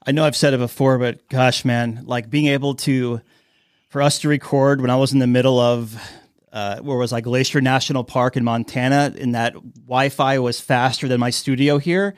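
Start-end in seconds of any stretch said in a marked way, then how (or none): none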